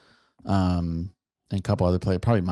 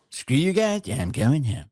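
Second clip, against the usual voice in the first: high-starting whiny cartoon kid; Cartman; 6 semitones higher, slower than usual. Quoting a favourite South Park line, high cartoon voice. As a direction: storm off like a cartoon brat.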